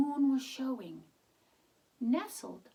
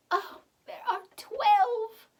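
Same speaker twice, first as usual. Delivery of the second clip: put-on delighted old woman; 8 semitones higher, 7 dB louder than usual. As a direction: marvel at the twelve babies.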